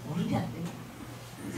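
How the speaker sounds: slow, breathy near-whisper; hushed. Acted. Preacher repeating the point to the congregation, in a slow hush. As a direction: let it sink in, barely voiced.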